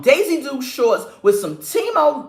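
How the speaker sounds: ordinary talk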